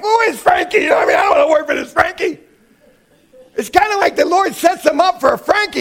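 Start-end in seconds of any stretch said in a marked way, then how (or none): 0.0-2.4 high-pitched, indignant protest; mock indignation. Voicing the listener's indignant reaction to Frankie.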